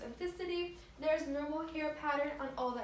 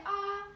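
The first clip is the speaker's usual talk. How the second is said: saying ahh; 6 semitones higher, 4 dB louder than usual. Shown as a demonstration. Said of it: "Please say".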